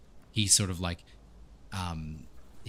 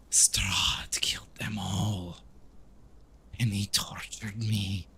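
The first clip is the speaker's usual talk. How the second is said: slow, whispery, drawn-out accusation; tormented vampire character; slower than usual. Acted. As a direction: drag out each word of the horror. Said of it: "and he says".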